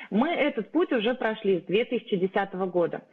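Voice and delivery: ordinary talk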